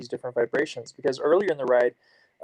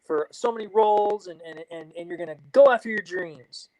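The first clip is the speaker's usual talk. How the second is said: imitating eager encouragement, higher; mock pep talk, upbeat and pushing; motivated; 6 semitones higher than usual. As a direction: talk her into chasing dreams. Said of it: "very motivatedly".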